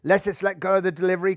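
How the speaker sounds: usual speaking voice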